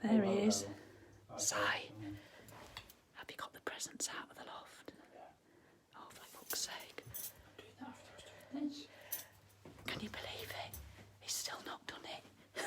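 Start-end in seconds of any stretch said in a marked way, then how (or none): 1.4-12.6 breathy whispered aside, barely audible; secret whisper. Real, not acted. Whispering to viewers about her husband, a conspiratorial hush. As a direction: whisper it so he won't hear.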